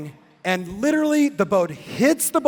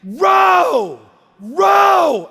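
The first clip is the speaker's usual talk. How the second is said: higher and louder shouted command; screaming; 8 semitones higher, 6 dB louder than usual. Retold or imitated. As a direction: bark the order over the noise. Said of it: "But she's screaming".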